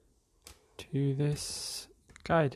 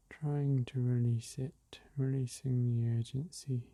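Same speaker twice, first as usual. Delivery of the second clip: guided meditation; slower than usual. Acted. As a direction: ease listeners into the breath gently.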